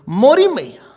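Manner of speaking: loud, high burst on Mori; spoken exclamation, punched hard; emphatic call. Shown as a demonstration. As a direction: call it out forcefully, falling on Maiya. Contrasts sung verse with call.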